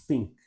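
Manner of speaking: Think, tongue tip out for the th; English th. Shown as a demonstration. Teacher models Think for students, tongue between teeth. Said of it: "fala think".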